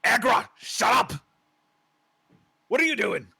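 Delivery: high, loud angry orc rebuke; orc shouting. Acted. Rival orc rebuking the shouting orc, angry yell. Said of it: "comes back and says".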